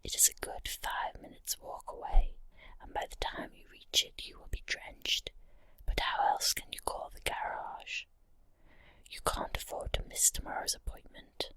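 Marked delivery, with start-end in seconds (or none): none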